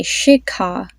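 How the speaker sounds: "Shikha" slowly, with a puff of air on the kh; aspirated "Shikha". Shown as a demonstration. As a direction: model "Shikha" for a learner to repeat, drawn out. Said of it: "Try to pronounce that with me".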